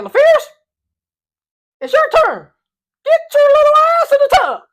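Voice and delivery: very high, loud squinted-mouth coach voice; coach's voice. Retold or imitated. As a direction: bark it like the coach with one eye squinted. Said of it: "he talks like this".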